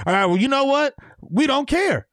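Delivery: high-pitched brush-off; mock dismissive. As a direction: play someone waving away a warning. Voices people dismissing New Yorkers' warnings.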